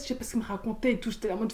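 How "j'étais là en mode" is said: normal talking voice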